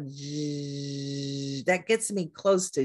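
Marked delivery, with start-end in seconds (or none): 0.1-1.6 j stretched into a long voiced zh. Shown as a demonstration. Teacher stretching j toward zh. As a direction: hold the j as a buzzing zh. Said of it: "now I'm gonna stretch it out".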